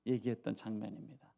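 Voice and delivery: usual speaking voice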